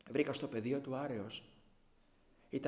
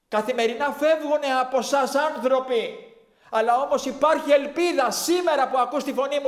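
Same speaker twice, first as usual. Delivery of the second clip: loud, high, full-force preaching cry; shouting loudly; 12 semitones higher, 18 dB louder than usual. Retold or imitated. Preacher shouting warnings to the crowd in the park. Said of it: "Άρχισα να φωνάζω δυνατά".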